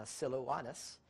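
Siloanus as sil-oh-AH-nus, slowly; careful Greek-style Siloanus, sil-oh-WAH-nus; correct pronunciation. Shown as a demonstration. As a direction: say Siloanus with a W glide and open AH. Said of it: "but it's actually".

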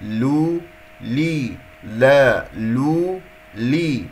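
لو، لي، لا as separate thin-l syllables; light lam drill. Shown as a demonstration. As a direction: drill each syllable with a light l and long vowel. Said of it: "لا، لا".

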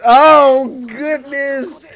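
high, loud burst on "Oh"; worked-up exclamation. Real, not acted. Reacting to the episode, high worked-up outcry. Repeats the reaction much more intensely.

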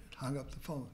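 ordinary talk, nothing marked

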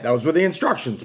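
ordinary talk

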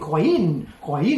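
"coin" split into coi-IN, second part stressed and loud; wrongly added stress. Shown as a demonstration. Teacher shows student the wrong stress, "coin" as coi-IN. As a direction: stretch "coin" into two syllables, hitting -IN. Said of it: "ถ้ามัน stress ด้วยเป็น".

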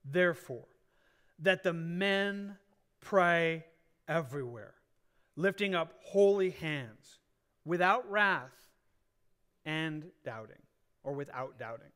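slow, deliberate reading of the verse; scripture reading. Acted. Preacher reading the verse to the church, slow and deliberate. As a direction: lay each phrase of scripture out slowly. Reads the scripture passage aloud.

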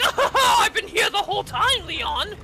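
high, laughing mock villain voice; giggly high-pitched taunt; gloating enemy. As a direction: pop out cackling, hammed-up. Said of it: "he pops out and he's like".

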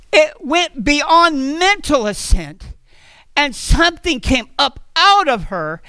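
ordinary delivery

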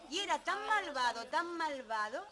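TAN MALVADO punched high and loud, twice; emphatic storytelling. A narrator's reading. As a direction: raise and hammer tan malvado. Narrator stressing the ogre's wickedness.

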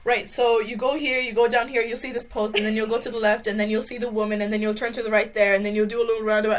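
imitating a local rattling off directions, speeding up to very fast; rattled-off directions. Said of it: "And they're like".

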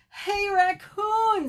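high, long-drawn hail; calling out. Acted. Exhausted Bird calls to Raccoon, calling out. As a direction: stretch the name out in a high call.

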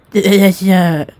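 put-on slipped into the wrong girl voice; lightly voiced wrong character. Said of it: "I mix voices".

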